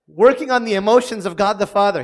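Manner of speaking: normal talking voice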